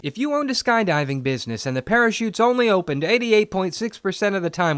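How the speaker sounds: ordinary talk, nothing marked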